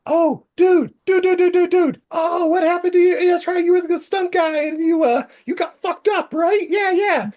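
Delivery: very high-pitched gushing dude voice; excited dude customer. Retold or imitated. Car owner recognizes the fallen stunt guy, high-pitched gushing. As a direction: squeal it like an overexcited fan. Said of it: "He's like".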